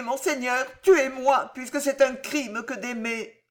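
high, desperate pleading wife; begging young wife. Acted. Jeanne begging the constable to spare François: high desperate plea. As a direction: throw yourself on his mercy.

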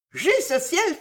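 high-pitched indignant exclamation; astonished and indignant. A narrator's reading. Cécile reacting to Delville's suspicion, astonished and indignant. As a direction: cry out high, shocked and affronted. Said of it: "s'écria Cécile étonnée et indignée".

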